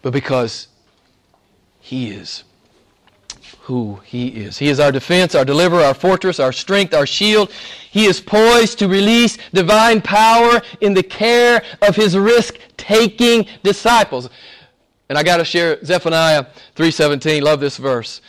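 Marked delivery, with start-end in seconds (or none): 4.6-14.3 louder, higher preaching build. Acted. Preacher proclaims God's power to the congregation, building. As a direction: lift the congregation with rising force. Rising sermon climax.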